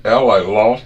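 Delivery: high, loud mock Southern grandma; nanny's Southern saying. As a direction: imitate nanny drawn out and loud. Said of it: "My nanny used to say".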